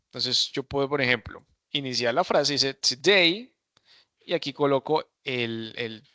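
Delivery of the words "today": Today lifted up in a rising wave; "Today" opened with a rising pitch. Shown as a demonstration. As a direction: let "Today" rise to stress it. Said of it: "como que subo un poquito la acentuación".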